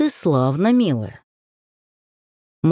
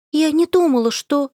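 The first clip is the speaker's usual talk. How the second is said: put-on guilty young girl; 9 semitones higher than usual. A narrator's reading. Varenka continues guiltily.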